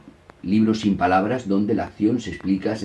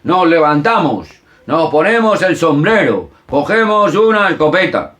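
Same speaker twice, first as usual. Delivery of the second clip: playful game call; 8 semitones higher, 7 dB louder than usual. Shown as a demonstration. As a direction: lead the children's game with a big call. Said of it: "utilizarlo después de leerlo como juego".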